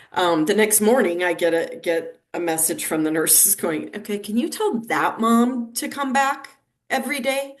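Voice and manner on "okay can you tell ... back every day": eager nurse. Retold or imitated. Nurses' message quoted.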